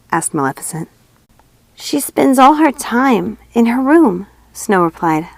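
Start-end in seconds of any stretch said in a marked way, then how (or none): 1.8-4.3 put-on young girl voice. A narrator's reading.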